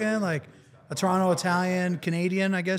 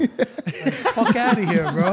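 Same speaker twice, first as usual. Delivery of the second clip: mock sister retort; 4 semitones higher, 5 dB louder, much slower than usual. Imitating sister's reaction.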